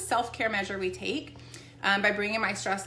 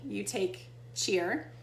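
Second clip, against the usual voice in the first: normal talking voice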